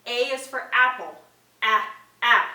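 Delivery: A is for apple, then the short a sound twice; letter sound demo. Shown as a demonstration. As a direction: sound out a, a clearly for a child. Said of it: "You can do the sound too".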